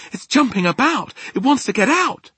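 loud, very high alarm; alarmed cry. A narrator's reading. Sophie watches the dream jump, high alarmed cry. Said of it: "Sophie cried".

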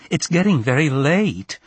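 a touch of maternal panic; panicked. A narrator's reading. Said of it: "in her voice the panic".

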